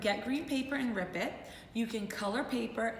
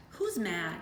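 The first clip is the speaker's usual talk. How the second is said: ordinary delivery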